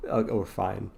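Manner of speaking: normal talking voice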